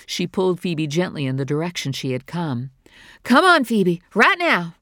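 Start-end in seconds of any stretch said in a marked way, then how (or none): 3.2-4.7 loud, higher firm order; firm command. Acted. Mother orders her daughter out of the pipe, firm and loud. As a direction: make her move now.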